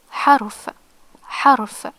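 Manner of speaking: "harf" sounded out as a separate Arabic word; demonstrated pronunciation. Shown as a demonstration. Teacher reads out the Arabic example "harf", demonstrated pronunciation. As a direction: give "harf" carefully, repeated after a pause. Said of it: "This word is".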